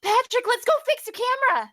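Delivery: gasp, then blurt it out thrilled; excited. Acted. Mila suddenly thrilled, urging Patrick, high fast excited call.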